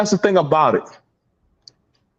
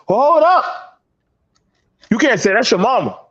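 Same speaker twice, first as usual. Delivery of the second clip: high-pitched imitated protest; mock objection; 7 semitones higher than usual. Objectors defending his mother, high mock protest. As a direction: cut in high, mimicking an objector.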